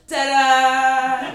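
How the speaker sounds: high, loud showy ta-da; triumphant ta-da. Retold or imitated. Throwing arms up at Giorgio Armani, loud high ta-da. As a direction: present it like a grand finale. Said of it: "and go".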